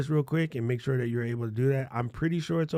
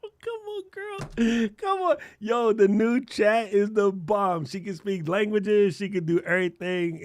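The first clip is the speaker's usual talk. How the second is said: squealing high and louder, drawn out; excited; 8 semitones higher, 4 dB louder, slower than usual. Real, not acted. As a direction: gush like you just saw magic. Hyped about new voice mode.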